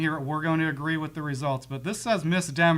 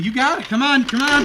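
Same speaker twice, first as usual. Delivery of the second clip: urgent urging; 8 semitones higher, 9 dB louder than usual. Real, not acted. Urging during timed game.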